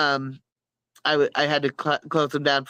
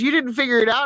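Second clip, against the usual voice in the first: teasing daughter; 8 semitones higher than usual. Retold or imitated. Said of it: "she goes".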